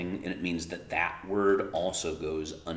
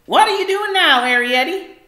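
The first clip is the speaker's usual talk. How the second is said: much higher, louder mother's call from another room; 16 dB louder than usual. Acted. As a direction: call out like a busy mum in the kitchen. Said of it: "called Homily from the kitchen".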